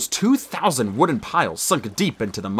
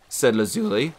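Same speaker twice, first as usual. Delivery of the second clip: usual speaking voice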